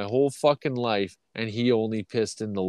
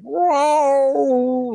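high, loud held whoa; mock startled whoa; 7 dB louder than usual. Startled by the yowling in the barn, high loud whoa. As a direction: jump at the sound.

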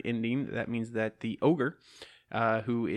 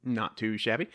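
normal talking voice

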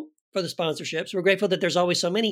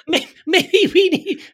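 laughing; 13 semitones higher, 6 dB louder, slower than usual. Real, not acted.

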